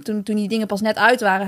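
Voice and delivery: normal talking voice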